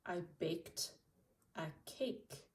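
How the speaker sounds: ordinary delivery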